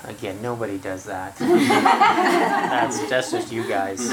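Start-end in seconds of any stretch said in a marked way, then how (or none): 1.4-4.1 laughing through a teasing line. Real, not acted. Teacher teasing the class, laughing. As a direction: giggle it out, teasing them. Joking after student's remark.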